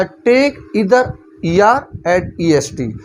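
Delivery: very slow, word by word rule reading; slow dictation. Shown as a demonstration. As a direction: read the rule out slowly for notes. Reads rule title aloud. (धीरे-धीरे नियम पढ़ना)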